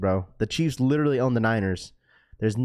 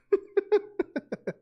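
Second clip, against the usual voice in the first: high laugh. Real, not acted. Laughing at his own joke.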